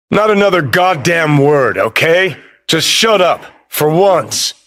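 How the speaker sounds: high, exasperated command; angry silencing. Acted. Ordering someone to shut up, exasperated and angry.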